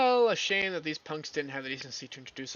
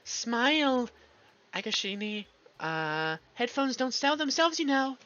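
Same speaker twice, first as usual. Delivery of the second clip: much higher, sing-song photographer voice; mock photographer; 8 semitones higher, slower than usual. Character mocking the photographer at the shoot, high and pushy. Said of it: "pressured into brain dead photo shoot".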